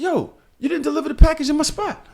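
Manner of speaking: high-pitched, accusing complaint; indignant retold confrontation. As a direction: act out calling the driver out. Retells confronting the delivery driver.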